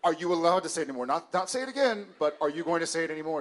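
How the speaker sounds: high, loud and fast, laughing through it; flustered laughing correction. Retold or imitated. Parents correcting son who repeats the word, flustered. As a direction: scramble to correct the misunderstanding.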